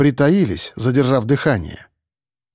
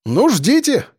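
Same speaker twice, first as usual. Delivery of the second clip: high, defiant call; shouted; 9 semitones higher, slower than usual. A narrator's reading. Defender taunting the waiting attacker, shouted. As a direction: shout back the dare. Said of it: "крикнул я".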